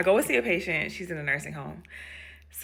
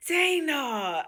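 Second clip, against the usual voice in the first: high gasped-in greeting, gliding down; drawn-out happy name call; delighted recognition; 7 semitones higher than usual. Retold or imitated. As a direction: imitate a patient thrilled by a visitor. Patient gasps on seeing her.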